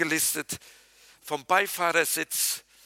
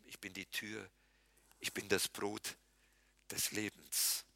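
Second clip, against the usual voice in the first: low, much softer, reverent recital of the sayings; hushed scripture recital; 8 semitones lower, 10 dB quieter than usual. Acted. Preacher to his congregation, reciting Jesus' sayings in a low hush. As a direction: let the words sink in, lower and softer with each line.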